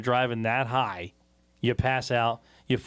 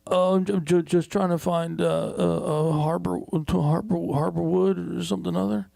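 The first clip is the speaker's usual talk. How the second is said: higher, stammering lost driver; hesitant retold stammer, pitched up; 4 semitones higher than usual. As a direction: imitate a stranger fumbling for the place. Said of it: "And he goes".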